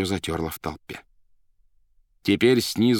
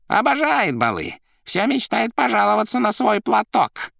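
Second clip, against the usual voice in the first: high, loud showman's voice; громкий высокий Коровьев; 14 semitones higher, 5 dB louder than usual. Acted. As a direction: announce it loudly over the crowd noise. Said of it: "громко говорил Коровьев". (громко, высоким голосом)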